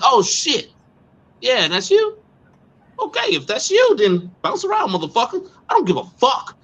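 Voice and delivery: high, mocking dismissal; defiant taunt. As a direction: taunt him as he drives off. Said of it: "I'm like".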